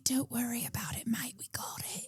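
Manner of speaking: whispered reassurance. Retold or imitated. Host retells gang man reassuring hero, whispered. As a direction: hush it like a secret, mate.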